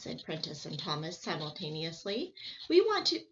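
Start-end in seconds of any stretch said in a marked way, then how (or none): 2.7-3.2 much higher and louder; eager. Acted. Boys begging to fly again, eager. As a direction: plead eagerly. Boys interrupting each other.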